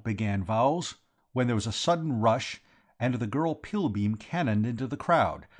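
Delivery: ordinary delivery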